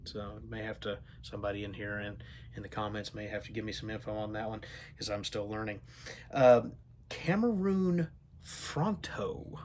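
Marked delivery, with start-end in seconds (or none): 6.3-9.6 "Cameroon Fronto" said slowly and high, sounded out; careful unsure pronunciation. Real, not acted. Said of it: "I hope I'm pronouncing that correct".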